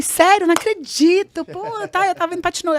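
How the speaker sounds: high, loud hammed-up surprise; mock astonishment. To the visa officer, a high, loud put-on amazement. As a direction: gush with fake amazement to win him over.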